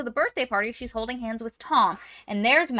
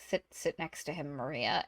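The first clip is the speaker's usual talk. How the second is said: lower, much softer, resigned order; reluctant dad; 5 semitones lower, 10 dB quieter than usual. Retold or imitated. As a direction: mumble it defeated under pressure. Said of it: "her dad has to be like".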